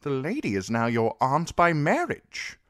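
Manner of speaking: urgently reminding the king; shocked. Acted. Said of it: "in a shocked voice".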